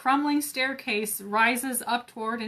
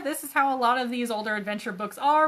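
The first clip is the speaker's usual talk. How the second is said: ordinary delivery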